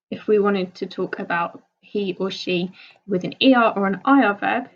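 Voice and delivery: ordinary talk, nothing marked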